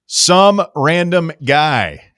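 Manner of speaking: loud, slow announcer call. Acted. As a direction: bring on the next caller like an announcer. Announcing next caller.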